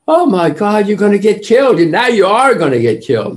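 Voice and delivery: high, loud mock alarm at a risky trip; hammed-up horror, gasping out the danger; alarmed warning. Locals warning travellers with high, loud mock alarm.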